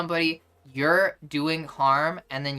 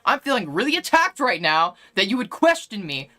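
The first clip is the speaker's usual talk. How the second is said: higher, pressing mock indignation; mock wounded accuser; 5 semitones higher, 3 dB louder than usual. Streamer mimicking an accuser who feels questioned, mock hurt. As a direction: play up being the attacked victim. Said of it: "that's really hurting my feelings".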